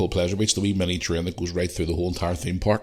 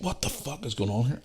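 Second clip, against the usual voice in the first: higher, much softer mock bewilderment; retold disbelief; 5 semitones higher, 9 dB quieter than usual. Man tugging at his stuck foot, retold bewilderment. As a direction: replay the shock small and high.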